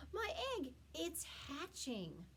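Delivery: high, then slow amazed wonder. Acted. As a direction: marvel at it slowly. Horton amazed.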